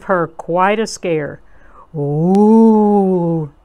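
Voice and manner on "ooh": long, loud ghostly "oooh"; drawn-out eerie howl; spooky wail. A narrator's reading. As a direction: wail to give someone a scare. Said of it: "making a wailing sound, a really spooky".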